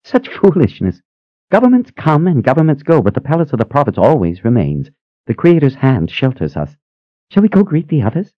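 put-on high, quick, eager old sister; bubbly high-pitched sister asking. A narrator's reading. Phoebe dismisses governments.

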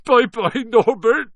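high, loud tearful wail; sobbing. Acted. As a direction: sob the goodbye. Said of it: "Hagrid sobbed".

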